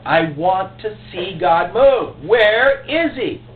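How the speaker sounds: loud, high pleading cry to God; impassioned preaching. Real, not acted. Pastor crying out to the church for revival, impassioned preaching.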